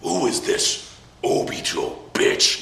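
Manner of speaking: slow, unvoiced villain voice; Obito. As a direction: whisper it slowly, punching the last word. Voices Obito's menacing reply.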